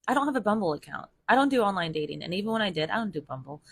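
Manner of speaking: ordinary delivery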